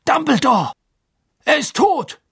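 high, loud cry of alarm; horrified cry. Acted. Official alerts the headmaster to the death, horrified cry. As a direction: call out the news in high, shocked tones. Official discovers the boy is dead.

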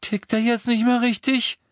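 very high, panicky question; alarmed croak. A narrator's reading. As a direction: ask in shock, voice shooting up. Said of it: "krächzte Fred mit erschrockener Miene".

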